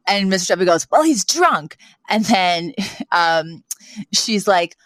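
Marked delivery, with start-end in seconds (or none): 0.9-1.8 imitating Sheffield's retort.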